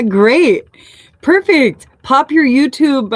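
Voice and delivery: high, loud burst of delight; suddenly thrilled, voice jumps up; excited praise. Real, not acted. Reader to artist, asking for their channel, high and excited.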